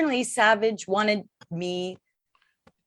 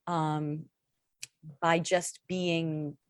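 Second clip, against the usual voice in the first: usual speaking voice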